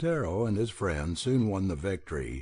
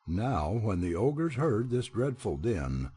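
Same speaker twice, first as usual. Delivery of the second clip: usual speaking voice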